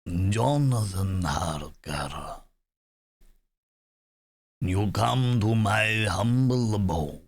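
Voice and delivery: very slow, sinister count; mock Transylvanian count greeting Harker; accented vampire. Shown as a demonstration. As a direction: savour each word like an old count. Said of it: "So it's got an accent".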